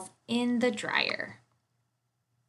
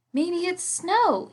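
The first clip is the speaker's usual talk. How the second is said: high, slow and full of wonder; a little bear thrilled by the sight; excited; 9 semitones higher, 3 dB louder, slower than usual. A narrator's reading. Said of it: "he said excitedly".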